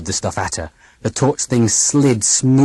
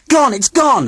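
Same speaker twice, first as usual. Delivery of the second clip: hysterical; 13 semitones higher, 4 dB louder than usual. A narrator's reading. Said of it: "started screeching with laughter".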